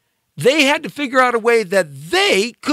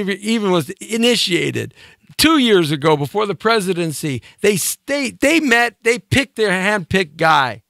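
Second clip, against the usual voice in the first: usual speaking voice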